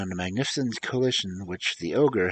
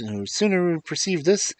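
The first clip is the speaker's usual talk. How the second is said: ordinary delivery, nothing marked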